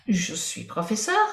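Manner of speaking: ordinary talk, nothing marked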